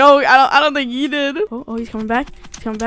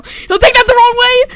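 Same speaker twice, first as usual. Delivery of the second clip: higher, louder outburst; exclaiming; 9 semitones higher, 7 dB louder than usual. Real, not acted. Player joking about a remark, exclaiming. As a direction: blurt it out loudly, pitched up. Joking reaction mid-game.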